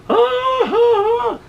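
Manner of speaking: very high, loud hammed-up guffaw; mock crowd laughter. Audience laughing at the heckler, hammed-up loud high cackle. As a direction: bray like a crowd laughing at a bad joke. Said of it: "like it was funny".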